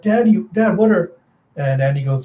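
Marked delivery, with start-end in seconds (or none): 0.0-1.1 higher, anxious daughter voice; young daughter. Acted. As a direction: lightly play a worried girl. Daughter questions her father urgently.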